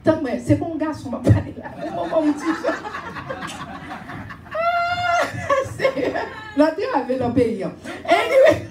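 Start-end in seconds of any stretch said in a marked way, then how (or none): 1.8-5.3 high laughter. Real, not acted. Laughs at own story.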